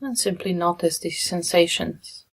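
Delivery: soft meditation guidance with long pauses; guided meditation. Real, not acted. Meditation leader guiding listeners into breath and body, softly and unhurried. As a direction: ease them gently through it, leaving long silences.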